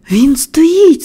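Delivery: high-pitched exclamation; excited cry. A narrator's reading. Friend announcing the boy stands, excited cry. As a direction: cry out in amazement. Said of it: "скрикнув Дікін".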